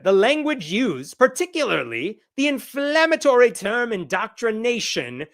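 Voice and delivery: high, put-on Irish accent. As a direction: read it out in an amateur Irish accent. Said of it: "this is my Irish accent".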